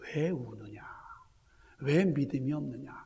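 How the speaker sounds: quiet, knowing rebuke; Jesus speaking softly. Acted. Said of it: "그 마음을 아시는 주님께서". (마음을 아시는 주님의 낮고 조용한 물음)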